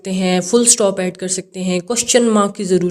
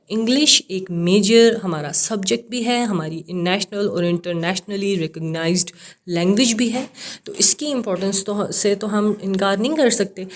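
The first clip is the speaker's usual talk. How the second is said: ordinary talk, nothing marked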